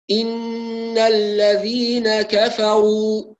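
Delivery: إن الذين كفروا recited very slowly with a held ghunna; model recitation. Shown as a demonstration. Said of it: "هكذا".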